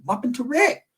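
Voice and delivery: high, anxious-sounding retold question; acted surprise. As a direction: ask as if stunned by the news. Acting shock at bad news.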